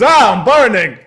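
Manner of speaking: loud, high pained wail; hammed-up burning cry. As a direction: cry out as the garlic burns. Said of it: "He goes".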